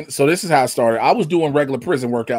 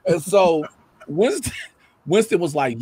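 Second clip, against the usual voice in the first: ordinary delivery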